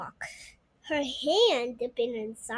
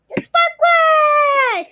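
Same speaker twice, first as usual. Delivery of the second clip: high, loud, slow cry of delight; excited gasp; 14 semitones higher, 11 dB louder, slower than usual. Acted. Child spotting the sparklers, gasping with delight. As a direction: squeal it with wonder. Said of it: "Zaina gasped".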